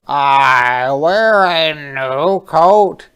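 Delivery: proud stoat. Acted. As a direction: show off a new coat. Stoat speaks in story.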